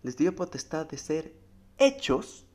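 rising to a loud high peak on hechos; scripture reading. Acted. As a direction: build the verse up to a loud cry. Reads scripture aloud. (lectura bíblica que culmina en un grito)

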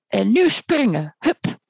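stern heron ordering a jump, drawn out; stern command. A narrator's reading. Heron coaches a frightened bird to jump, stern. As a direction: give a slow, firm order. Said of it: "riep de reiger streng".

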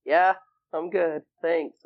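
retold reassurance. Reassuring his worried friends, retold high and drawn out. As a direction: stretch it out high, insisting he's fine.

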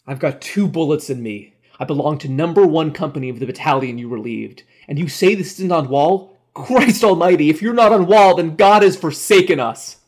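building from firm protest to loud, high outcry; mounting desperation. A narrator's reading. Wounded soldier to an officer, increasingly desperate. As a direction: let the protest swell into despair.

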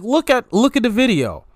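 high-pitched, insistent retold urging; urgent urging. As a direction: imitate a friend pushing you to look. Friend urging him to watch.

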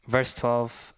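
ordinary talk

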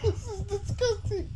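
high laughing groan of disgust; disgusted laughter. Real, not acted. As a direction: laugh through the gross taste. Laughing after tasting durian.

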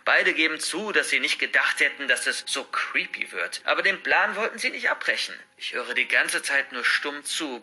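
ordinary delivery, nothing marked

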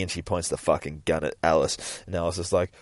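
normal talking voice

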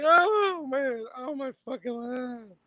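high mock sobbing whine; mock crying. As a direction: whine like a crybaby. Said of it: "then you start to cry".

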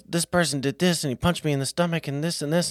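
put-on high-pitched child complaining; imitating his son. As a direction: rattle it off like an upset little boy telling on someone. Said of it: "he goes".

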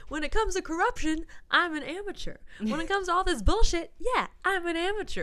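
rally the crowd with a comeback; rousing speech. Retold or imitated. Candidate turning the insult back on the president, rousing.

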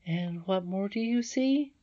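high, drawn-out question climbing at the end; questioning princess. A narrator's reading. Irene questions Curdie further, high and searching.

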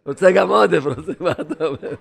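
words breaking into a laugh; real laughter. Real, not acted. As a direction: talk through laughter. Laughing over the joke's punchline. (מדבר מתוך צחוק)

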